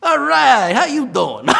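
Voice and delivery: high, loud, laughing street greeting; loud natural self. Retold or imitated. To the teacher, loud laughing casual greeting. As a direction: blurt it out big and unpolished, laughing. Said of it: "so I go".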